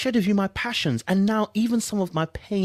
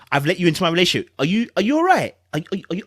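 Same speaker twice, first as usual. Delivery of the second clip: usual speaking voice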